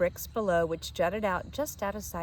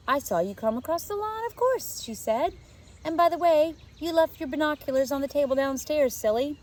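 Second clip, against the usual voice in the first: put-on proud little girl; 7 semitones higher, 4 dB louder than usual. A narrator's reading. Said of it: "Emma smiled proudly".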